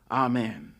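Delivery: ordinary delivery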